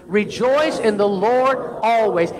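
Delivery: usual speaking voice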